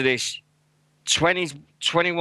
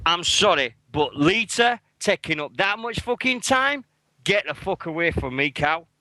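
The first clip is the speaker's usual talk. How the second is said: angry rant; 6 semitones higher than usual. Real, not acted. Ranting about Hall of Fame speech.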